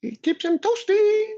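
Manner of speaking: very high, slow squeaky critter; gerbil voice. Retold or imitated. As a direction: squeak it like a tiny rodent. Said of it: "that Quiznos commercial with the gerbils".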